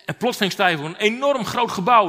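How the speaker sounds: ordinary delivery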